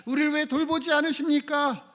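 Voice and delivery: high, urgent cry for help; acted desperate appeal, rising in pitch; fearful plea. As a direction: plead as the frightened disciples. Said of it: "고난을 겪으며 두려워하고".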